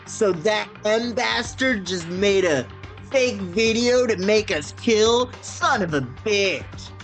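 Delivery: slow, hammed-up anime hero voice; exaggerated anime protagonist voice. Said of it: "exaggerated anime protagonist voice".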